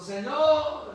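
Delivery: a loud, high desperate cry. Acted. El necesitado clama a Jesús, gritando con fuerza. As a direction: cry out to the Lord for mercy. Supplicant crying out to Jesus.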